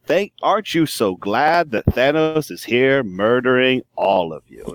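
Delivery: booming mad prophet, slow and drawn out; slow, grandiose sermon with total conviction; Corvus Glaive. Acted. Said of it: "Corvus Glaive speaks like a prophet".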